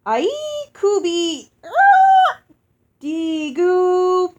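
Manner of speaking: loud, high, joyful giant hostess greeting; booming giantess. Acted. Giantess Uoxi welcoming her guests, loud and joyful. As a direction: boom it out like a delighted giantess.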